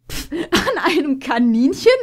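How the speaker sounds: incredulous laughing gasp, loud and high; laughing in disbelief. A narrator's reading. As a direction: splutter with laughter at the idea. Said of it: "japste Puma".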